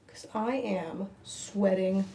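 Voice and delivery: usual speaking voice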